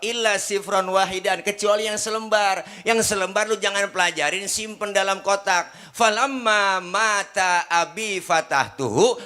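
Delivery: suara tinggi, lantang berkhotbah; penuh semangat berdakwah. Acted. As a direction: kisahkan dengan semangat menggebu. Preaching at full force. (penceramah berseru kepada jamaah dengan semangat)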